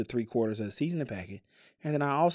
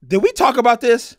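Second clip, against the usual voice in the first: high, loud startled question; loud, high-pitched disbelief; startled realization; 11 semitones higher, 12 dB louder than usual. Real, not acted. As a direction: burst out as if suddenly remembering. Startled by forgotten peanut butter talk.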